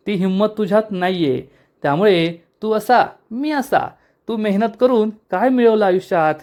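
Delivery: scheming younger brother boasting to his captive elder brother, sneering; gloating villain. Acted. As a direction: lord it over him, mocking. Villain Udaykant taunts brother.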